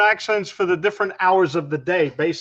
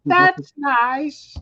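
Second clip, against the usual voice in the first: higher, mock-polite that's nice; 7 semitones higher than usual. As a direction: parrot the catchphrase. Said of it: "Just say".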